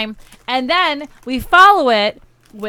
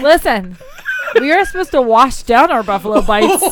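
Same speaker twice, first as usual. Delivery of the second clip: laughing while talking, louder; 2 semitones higher, 6 dB louder than usual. Real, not acted. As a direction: explain through laughter. Laughing defence after being teased.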